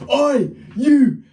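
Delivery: high, loud shout; imitating an angry dad shouting; angry call-out. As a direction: holler to stop someone in their tracks. Angry dad calls out in story.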